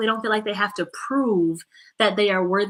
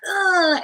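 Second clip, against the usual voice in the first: high, loud frustrated groan; sudden mock groan of frustration; frustrated outburst; 9 semitones higher, 5 dB louder than usual. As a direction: mimic a kid's fed-up "uh". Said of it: "super frustrated and they can't do something".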